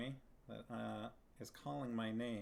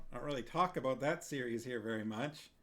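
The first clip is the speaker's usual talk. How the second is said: usual speaking voice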